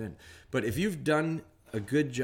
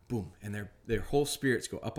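ordinary talk, nothing marked

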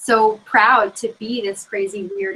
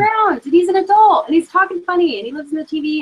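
higher, louder, wide-eyed gush; breathless childhood awe, pitched up; kid's awestruck excitement; 7 semitones higher, 3 dB louder than usual. Retold or imitated. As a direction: gasp it out like a kid seeing her hero. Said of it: "I was like".

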